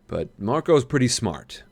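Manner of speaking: ordinary delivery, nothing marked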